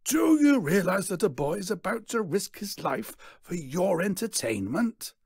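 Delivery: loud, high-pitched showman's indignation; theatrical outraged rebuke. A narrator's reading. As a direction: raise the voice sharply, scolding the crowd. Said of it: "his voice is raised as if in anger".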